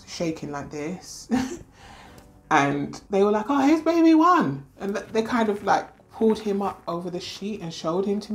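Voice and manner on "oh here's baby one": much higher, louder cheerful reveal; excited announcement. Retold or imitated. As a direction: announce the baby with delight. Staff presenting the first twin.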